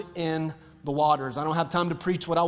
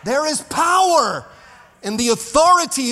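preaching shout; 7 semitones higher, 3 dB louder than usual. Real, not acted. Pastor crying out to the congregation, preaching shout. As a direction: lift the room with it. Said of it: "I feel like preaching today".

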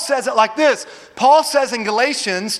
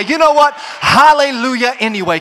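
loud, high hallelujah anyway; defiant praise; 3 semitones higher, 6 dB louder than usual. Acted. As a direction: shout it out against the trouble. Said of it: "you lift your hands and say".

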